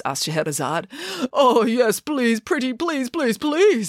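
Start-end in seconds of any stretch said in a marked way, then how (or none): 1.3-3.9 high, eager begging; desperate pleading. A narrator's reading. The king begs Scheherazade for another slice, pleading. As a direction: plead higher with each repeated please.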